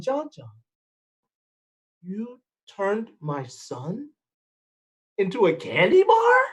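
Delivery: slow, rising to a shouted question; a mother who can't believe it; disbelieving mama. Acted. Mother accuses son in disbelief.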